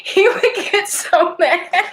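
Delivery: real laugh breaking through, pitched high; laughing hard. Real, not acted. Recalling the teased friend, laughing hard. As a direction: squeeze the words out through laughter. Laughing at her own story.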